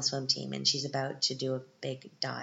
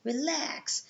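lightly voiced creepy stalker, higher; creepy snake guy; 9 semitones higher than usual. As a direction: croon it like a creepy street guy, rising high on relax. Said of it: "the crazy snake guy... he's like".

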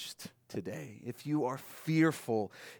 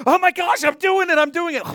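very high, loud squeal of panic; the kid sliding from thrill into panic on the bike; panicking kid; 13 dB louder than usual. Retold or imitated. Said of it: "suddenly they go".

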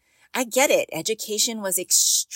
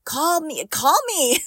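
higher and louder, pleading playfully; bright urgent repeat; eager call; 8 semitones higher, 4 dB louder than usual. Real, not acted. Urging listeners to call.

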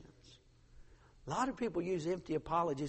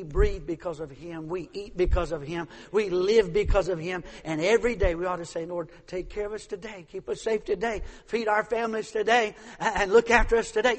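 louder and louder preaching; building sermon; 7 dB louder than usual. Acted. Preacher rousing the congregation, louder each line.

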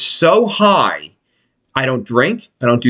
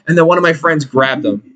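normal talking voice

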